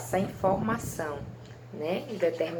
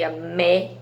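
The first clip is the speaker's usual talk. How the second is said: louder, isolated "mé" in "a mé"; stressed syllable; 6 dB louder than usual. Shown as a demonstration. Teacher naming the strongest syllable, "mé" said with force. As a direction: lean hard on "mé" by itself. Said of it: "é a sílaba mais forte".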